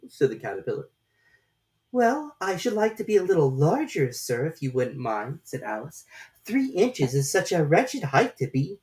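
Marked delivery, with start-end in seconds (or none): 1.9-8.8 put-on high, light young girl voice; a polite little girl, voiced high; Alice's girlish voice. A narrator's reading. Alice answering the caterpillar in a high girlish voice.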